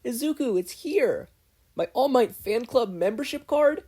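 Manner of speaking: high, bright teasing mom voice; excited mom. A narrator's reading. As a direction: tease him with mock excitement.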